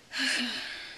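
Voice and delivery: a loud exasperated huff; griffin's loud sigh. A narrator's reading. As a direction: heave a big sigh. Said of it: "exclamation of".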